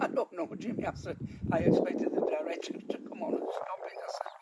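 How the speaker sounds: giggly half-whisper. Retold or imitated. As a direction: speak under the laughter so the director won't hear. Giggling whispered asides on stage.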